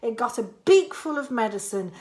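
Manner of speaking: ordinary delivery, nothing marked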